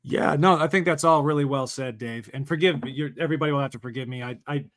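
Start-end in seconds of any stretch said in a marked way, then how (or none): none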